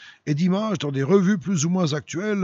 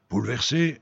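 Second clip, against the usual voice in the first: ordinary delivery, nothing marked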